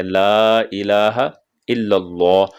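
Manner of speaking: slow ला इलाहा इल्लल्लाह with full heavy lam. Shown as a demonstration. Teacher modelling a full lam in the adhan. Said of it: "लाम को पुर पढ़ने".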